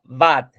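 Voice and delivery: "vad" with an open A, as students said it; wrong open A. Retold or imitated. Teacher quoting most students' mistake, "vad" with open A. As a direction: imitate the mistaken "vad", vowel like Spanish A. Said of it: "la mayoría me dijo".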